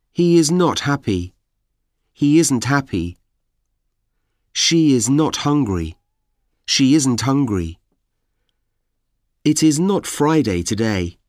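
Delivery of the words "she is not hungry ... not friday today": ordinary talk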